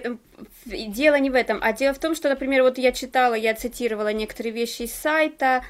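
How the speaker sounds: ordinary talk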